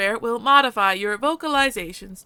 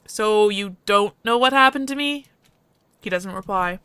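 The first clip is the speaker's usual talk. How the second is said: ordinary delivery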